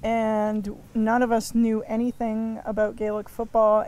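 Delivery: usual speaking voice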